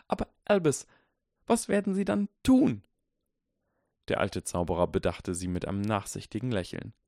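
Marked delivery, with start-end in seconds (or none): 0.1-2.8 higher, urgent plea; urgent professor. A narrator's reading. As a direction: insist firmly to the headmaster. Minerva voiced in story.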